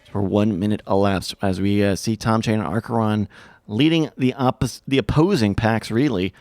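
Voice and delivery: quick, upbeat caster voice; brisk esports commentary; race play-by-play. Acted. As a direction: call the race like a sportscaster. Casts the race.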